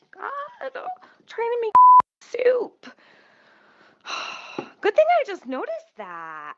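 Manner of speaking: exasperated whine. Real, not acted. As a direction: whine it out at yourself. Exasperated at herself.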